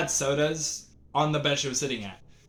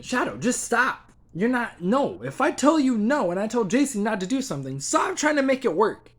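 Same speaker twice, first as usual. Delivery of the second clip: high-pitched, fed-up telling-off; exasperated scolding; 8 semitones higher than usual. Retold or imitated. Teller telling Shadow to stop in their mind, exasperated. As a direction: lay down the law, pitch riding high.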